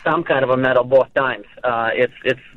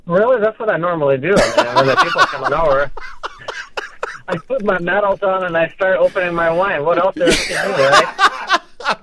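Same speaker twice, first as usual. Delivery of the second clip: put-on Russian accent; 4 semitones higher than usual. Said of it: "for doing that accent".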